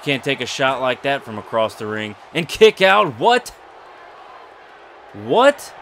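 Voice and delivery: outraged cry at a kick-out; stunned shout. Real, not acted. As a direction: shout it in disbelief at the kickout. Kickout after huge coast-to-coast dive.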